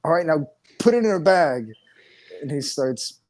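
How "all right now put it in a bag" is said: put-on smug kid order. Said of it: "young Sheldon is like".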